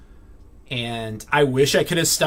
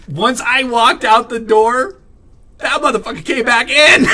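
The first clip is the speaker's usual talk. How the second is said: loud, high, incredulous rant; indignant outburst; 8 semitones higher, 7 dB louder than usual. Real, not acted. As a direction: blurt the outrage, building. Indignant punchline of workplace story.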